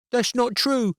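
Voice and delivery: put-on high child's voice protesting; high young boy voice; defensive boy. A narrator's reading. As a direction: whine the denial like a small boy.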